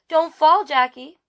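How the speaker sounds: big sister's mock alarmed warning; calling out. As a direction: call after the toddler as she runs. Big sister calls after running baby.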